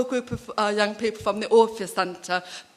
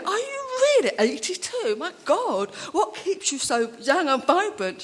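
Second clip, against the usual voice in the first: much higher, astonished; amazed disbelief; 6 semitones higher than usual. Retold or imitated. Organizer marvels at an eighty-two-year-old volunteer, amazed and much higher. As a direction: gush in wide-eyed amazement.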